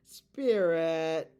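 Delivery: loud summoning call. Acted. Summoning the Spirit with a loud call. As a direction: call the Spirit loudly into the room.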